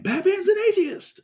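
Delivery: very high mimicking squeal; mock outcry. Co-host mimics people saying Batman is an atheist, mock high squeal. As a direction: parrot the claim in a silly high voice. Mimicking alarmed reports about comic.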